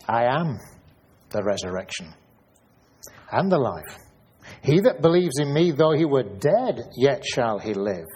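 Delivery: solemn reading swelling at the end; scripture reading. Acted. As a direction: proclaim it with rising force. Reading Jesus' words aloud.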